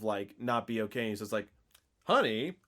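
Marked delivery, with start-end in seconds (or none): 2.1-2.6 higher, singsong concerned husband; goofy, oblivious questioning, lifted in pitch; clueless Matthew. Retold or imitated. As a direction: play a husband missing the obvious. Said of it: "just one of the goofiest scenes".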